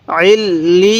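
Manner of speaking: "আইল লি" slow, l doubled and ee drawn out into a y; doubled letters. Shown as a demonstration. Teacher showing learners letters pronounced twice, slow drawn-out joining. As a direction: lean on the double l in "আইল লি", stretching the vowel. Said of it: "ইয়াটাও দুইবার উচ্চারণ হবে".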